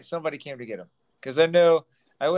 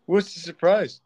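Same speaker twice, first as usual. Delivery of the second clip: laughing; 2 semitones higher, 4 dB louder than usual. Real, not acted. Asks while laughing at joke.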